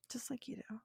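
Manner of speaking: very soft, breath-only whisper; whispered repeat. Real, not acted. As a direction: whisper it knowingly to the listener.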